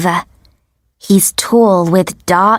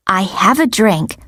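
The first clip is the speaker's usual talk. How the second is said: ordinary delivery, nothing marked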